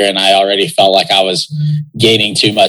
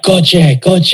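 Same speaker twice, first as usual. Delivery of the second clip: teammates calling out; 9 semitones higher than usual. Retold or imitated. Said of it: "and they were like".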